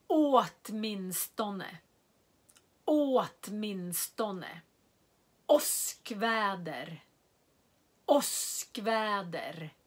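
Åtminstone long å, Åskväder short å, both slow; careful repeated demo; slow model words. Shown as a demonstration. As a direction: contrast long and short å slowly. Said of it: "jag säger alla orden igen".

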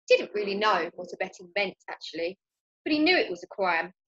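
ordinary delivery, nothing marked

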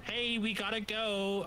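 slow, high call through a megaphone; drawn-out hailing yell; mock megaphone yell. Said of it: "yell at you in the megaphone".

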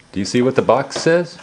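ordinary delivery, nothing marked

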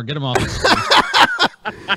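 slow, drawn-out, disgusted; disgusted demand. Real, not acted. Host fed up with the guest, slow and disgusted. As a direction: drag it out like you're sick of him. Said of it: "I can't look at this fuck anymore".

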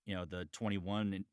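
ordinary talk, nothing marked

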